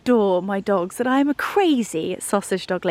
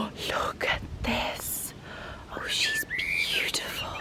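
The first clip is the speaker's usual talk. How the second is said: awed hushed whisper; 9 dB quieter than usual. Real, not acted. Vlogger whispering about a flower she loves. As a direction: whisper after a gasp of delight. Gasp then admire.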